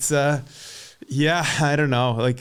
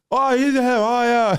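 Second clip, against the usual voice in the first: high, loud sudden recognition; a local bakery woman going oh, got it; Flemish woman realizing; 10 semitones higher, 4 dB louder than usual. Retold or imitated. Local woman finally understands him.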